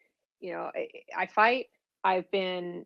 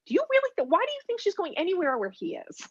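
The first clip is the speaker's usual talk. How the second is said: much higher, mocking disbelief; scoffing disbelief; 11 semitones higher, faster than usual. Lawyer scoffing at agents on the phone, incredulous. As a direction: scoff at a ridiculous idea.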